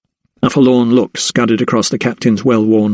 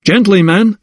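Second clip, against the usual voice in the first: cried out; 9 semitones higher, 3 dB louder than usual. Acted. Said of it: "he cried".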